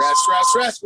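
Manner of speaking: high, loud call of rest; shouted call. Real, not acted. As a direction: yell it across the room to stop the class. Coach calls the rest break.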